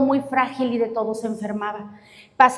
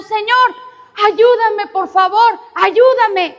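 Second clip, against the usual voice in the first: crying out; 6 semitones higher, much slower than usual. Acted. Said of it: "entra la señora gritando".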